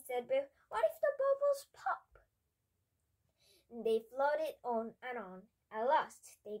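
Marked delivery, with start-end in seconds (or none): 0.7-2.2 very high-pitched, anxious little voice; high, alarmed character voice; frightened child. A narrator's reading. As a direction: squeak it out like a worried child. Biff fears the bubbles popping.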